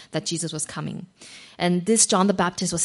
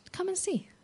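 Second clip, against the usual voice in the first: soft invitation; 12 semitones higher, 10 dB quieter than usual. Acted. Simple invitation, soft and high.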